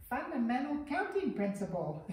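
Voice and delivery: squeaky high-pitched toy repeating it back; a creepy recorded-toy squeak. Retold or imitated. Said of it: "repeat it to you in this really high-pitched, like, creepy voice".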